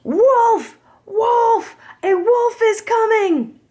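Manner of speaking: high, loud cry for help; shouting. Acted. Shepherd boy shouting a fake alarm to the villagers, loud high yell. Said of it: "started shouting in the direction".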